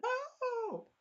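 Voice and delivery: thin, very high falsetto no; mock scream. Daughter screaming as she's kicked off, high mock scream. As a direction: imitate a faraway scream in falsetto. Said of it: "she's like screaming".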